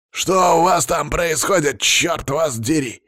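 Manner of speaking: high, loud, drawn-out bellow; irate boss hollering down the line; angry boss shouting. A narrator's reading. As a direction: yell a slow, furious demand. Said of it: "услышал я из наушников крик Палыча".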